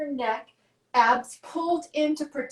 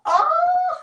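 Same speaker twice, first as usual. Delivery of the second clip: straining groan; 5 semitones higher, 4 dB louder, slower than usual. Real, not acted. Straining during exercise.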